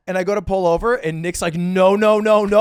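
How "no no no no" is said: loud, insistent retold protest; urgent mock refusal. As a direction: voice rising louder with each word. Retold panicked refusal to pull over.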